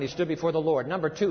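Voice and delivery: ordinary talk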